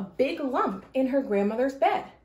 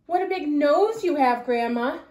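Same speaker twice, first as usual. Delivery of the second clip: put-on girl voice; 4 semitones higher, 5 dB louder than usual. Girl voice, louder.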